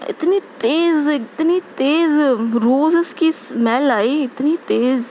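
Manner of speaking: इतनी तेज़ drawn out slowly and pitched high; emphatic stress on तेज़. Retold or imitated. Devotee telling the congregation of a sudden rose scent, emphatic drawn-out तेज़. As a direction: stretch तेज़ high for sheer intensity.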